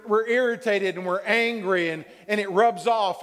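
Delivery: normal talking voice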